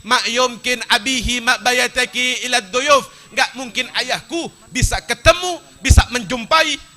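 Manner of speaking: loud, high-pitched sermon storytelling; full-force preaching. Acted. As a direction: declaim the sacred story to a big crowd.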